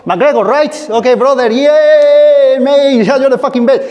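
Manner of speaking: butter him up cheerfully; friendly flattering banter. Retold or imitated.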